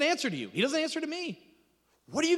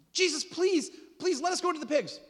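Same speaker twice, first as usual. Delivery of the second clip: high, whiny pleading demons; begging demons; 10 semitones higher, 3 dB louder than usual. Acted. Preacher voicing demons begging Jesus, high and whiny. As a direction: beg him like a desperate demon.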